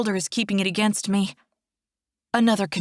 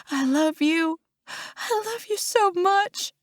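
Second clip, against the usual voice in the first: high, choked-up grieving; anguished, high-pitched declaration of love; tearful farewell; 10 semitones higher, slower than usual. A narrator's reading. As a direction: say goodbye through tears, climbing higher. Farewell to dead brother.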